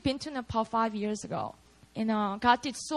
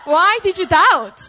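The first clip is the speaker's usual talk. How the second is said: loud, slow, higher-pitched rebuke; condemning; 5 semitones higher, 12 dB louder than usual. Shown as a demonstration. Jesus seeming to condemn Peter, loud slow rebuke. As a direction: thunder it slowly as an accusation. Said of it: "If you just read it like that".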